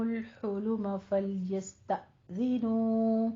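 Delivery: slow, drawn-out tajweed chant; Quran recitation. Acted. Teacher recites the verse for her students, slow melodic chant. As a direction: recite the verse melodically, stretching each vowel.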